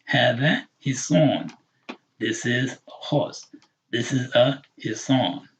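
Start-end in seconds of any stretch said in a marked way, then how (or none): none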